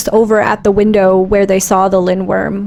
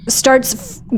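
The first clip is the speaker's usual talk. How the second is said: normal talking voice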